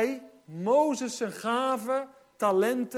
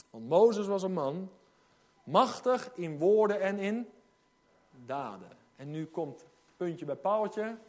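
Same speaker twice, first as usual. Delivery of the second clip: ordinary talk, nothing marked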